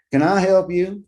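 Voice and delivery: a wary guard's question; security guard asking. A narrator's reading. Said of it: "he asks".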